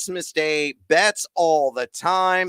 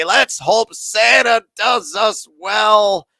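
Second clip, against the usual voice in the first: hyped holiday wish; 6 semitones higher, 6 dB louder, much slower than usual. Real, not acted. Host's high-energy holiday sign-off.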